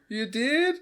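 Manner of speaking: very high, loud questioning call; surprised shout back. Acted. Imitating Pickles answering Nathan, high startled shout. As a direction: squawk the question back across the hall.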